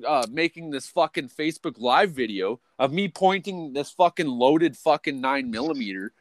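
usual speaking voice

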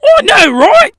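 high, loud fake British yelp; hammed-up British outburst; terrible British accent. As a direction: exclaim in a bad British accent. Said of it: "terrible British accent".

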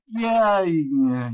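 high, loud opening yeah that drops away; retold drawn-out yeah, sliding down; knowing agreement. Narrator answering the author's admission, knowing agreement.

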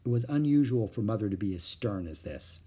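plain reading voice

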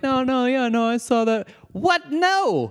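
high, far-off mocking imitation; mocking quote. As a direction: mimic them as if obviously right. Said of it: "and they're like".

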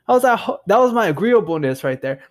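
usual speaking voice

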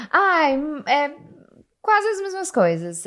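high laughing voice, words rising out of the laugh; laugh, then talk through it. Real, not acted. Laughing after the joke.

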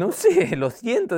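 high, squeaky apology; frightened kid. Retold or imitated. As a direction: cower and squeak it out. Child squeaking in fear.

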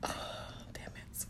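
a soft, breathy curse after a sigh; tearful whisper. Real, not acted. About to tell how her father died, whispered curse. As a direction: sigh and whisper it, fighting tears. Sighs, holding back tears.

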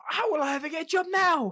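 high, loud whining despair; hammed-up hopeless student; mock despair.

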